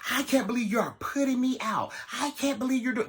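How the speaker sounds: high whiny mock of his complaint; mocking dramatic boyfriend. Mocking her date's dramatic complaints, high and whiny. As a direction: make him sound overdramatic and whiny. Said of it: "just repeating himself, just being so drama".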